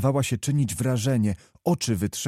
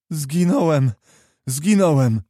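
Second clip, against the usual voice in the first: raised, despairing wail; moaning; 4 semitones higher, 5 dB louder than usual. A narrator's reading. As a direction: moan it out, louder the second time. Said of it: "jęczał Kowalski". (jęk rozpaczy)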